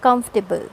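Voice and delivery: comfortable as KUMF-tuh-bul; comfortable squeezed into three syllables; three-syllable pronunciation. Shown as a demonstration. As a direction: stress the first part of comfortable, drop the "or". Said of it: "the pronunciation of the word is".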